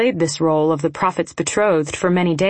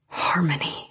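raspy, soft whisper; rasped; 6 dB quieter than usual. A narrator's reading. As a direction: rasp the name under your breath. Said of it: "he rasped".

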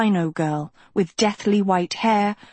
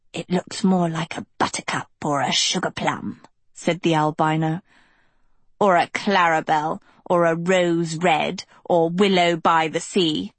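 sneering albino girl, mocking; sour albino girl.

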